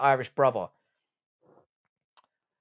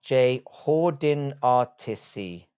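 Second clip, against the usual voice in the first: slow careful spelling; 2 semitones lower, much slower than usual. Shown as a demonstration. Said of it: "such a hard word to spell".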